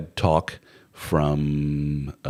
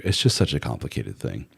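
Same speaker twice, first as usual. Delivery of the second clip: ordinary talk, nothing marked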